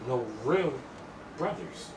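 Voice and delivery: ordinary talk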